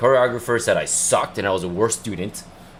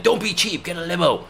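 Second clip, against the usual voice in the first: higher, scolding mock father; imitating dad's scolding, pitched up; nagging dad; 8 semitones higher than usual. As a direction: whine at him like a stingy-hating dad. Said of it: "he was like".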